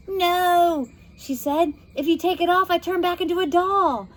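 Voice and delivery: worried little sister; younger girl voice. A narrator's reading. Anna warns Josie about the necklace.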